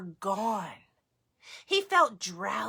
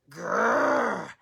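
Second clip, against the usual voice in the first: bear growl; 4 dB louder than usual. Acted. Bear grumbling at Baba Brena, growling.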